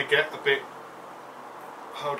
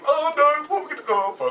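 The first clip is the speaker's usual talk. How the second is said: mock panic; 12 semitones higher, 6 dB louder, faster than usual.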